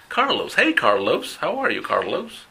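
playful gringo greeting with long L; gringo accent. Retold or imitated. Teacher imitating an American friend greeting him. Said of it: "cuando lo pronuncia un gringo".

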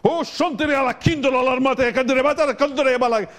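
high, rapid burst of made-up tongues; mock tongues outburst. Mom to stubborn son, gushing mock tongues in a high voice. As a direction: gush prayer language at the son to scare him. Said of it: "just break out all over him in the prayer language".

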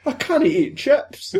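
very high, drawn-out Scottish wail; hammed-up high Scottish whine; most Scottish accent. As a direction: stretch out a laughing Scottish lament. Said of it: "in the most Scottish accent".

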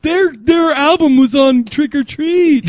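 mock gushing fan. Gushing fan in put-on high voice.

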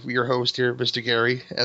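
ordinary talk

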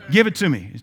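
mock-stern order to hand it over; retold demanding mother. Retold mother demands the tape deck.

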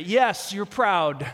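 ordinary talk

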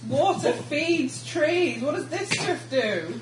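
puzzled character voice. Acted. Baffled rainforest character.

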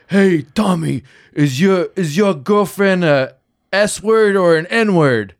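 put-on loud, slow Boston tough-guy accent; thick Boston accent, drawn out. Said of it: "in a Boston accent".

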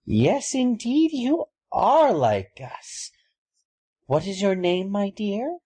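high, slow, eerie bird-woman voice; drawn-out and high, like a curious raven woman; raven folk lady. Acted.

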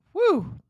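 loud, high-pitched "whoo"; high whew. Real, not acted. Reacting to all there is about Sasha, a loud high whew. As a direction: let out a sudden high whoop.

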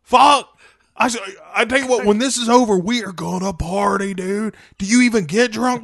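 high, loud starstruck bro; gym bro. Acted. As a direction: gush like a fanboy bro. Aquaman fanboying at Superman.

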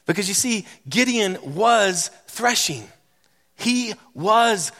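a pastor building a fervent refrain over music; preaching at full force. Acted. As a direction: lift the room with rising declarations.

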